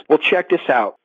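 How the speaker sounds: ordinary talk, nothing marked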